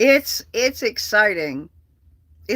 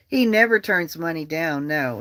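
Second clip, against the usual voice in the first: ordinary delivery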